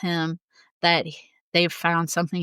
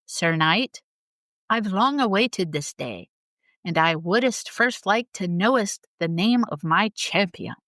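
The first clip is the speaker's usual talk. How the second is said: ordinary talk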